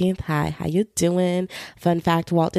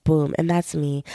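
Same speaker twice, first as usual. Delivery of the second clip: usual speaking voice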